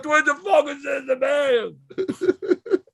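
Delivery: very loud, high imitated holler; high-pitched full-voiced shout; yelling across floor. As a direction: bellow a greeting across a crowded station, drawn out. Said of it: "he yells out across the floor".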